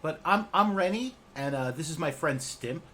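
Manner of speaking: bright eager little goblin introducing himself; cheerful goblin Rennie. Acted. Goblin greets the party at the tavern, high and chipper.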